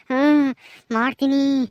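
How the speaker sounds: low threatening vow; vengeful ghost. Acted. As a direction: hiss the names with threat.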